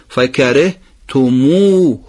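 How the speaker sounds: slow فکرهتموه with a short final ه; no lengthening. Shown as a demonstration. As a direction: end فکرهتموه crisply, no long vowel on the ه. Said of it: "قبل از های ضمیر حرکت کشیدهٔ او هست". (های ضمیر بدون اشباع)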